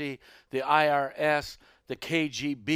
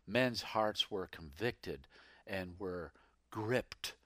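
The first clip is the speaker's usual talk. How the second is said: normal talking voice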